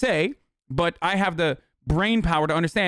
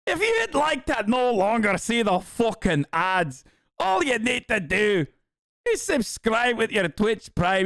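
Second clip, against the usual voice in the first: higher, slow hammed-up Scottish ad read; Scottish accent; 5 semitones higher than usual. Streamer redoing his ad break plug in a mock Scottish accent. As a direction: ham up a Scottish announcer plugging subs. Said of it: "Why am I doing that with, uh, without the accent?".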